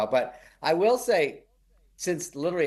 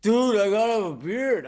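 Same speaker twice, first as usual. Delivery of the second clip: high, loud whiny demand; mock whining actor, higher and louder; lightly hammed-up pleading costar; Schwartzen's demand; 9 semitones higher, 5 dB louder than usual. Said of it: "Swartzen was one".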